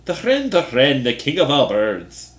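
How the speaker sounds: loud, high, hammed-up Irish accent; bad Irish accent. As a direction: belt it out in a botched brogue. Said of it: "Like, it's so bad".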